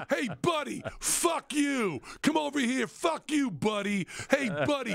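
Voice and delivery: high, shouted American insults; hostile American accent. Acted. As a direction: pick a fight in a hammed-up American accent. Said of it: "nothing but hostility".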